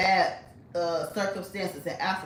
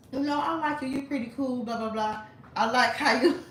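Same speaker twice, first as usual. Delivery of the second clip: put-on Nigerian accent; 6 semitones higher than usual. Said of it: "I'ma do the accent".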